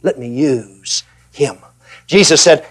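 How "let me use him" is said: quiet, slow "Let me surrender to God"; quiet surrender, slowed right down; soft and humble. Shown as a demonstration. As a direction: drop the voice low and humble. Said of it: "instead of".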